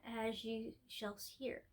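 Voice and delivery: plain reading voice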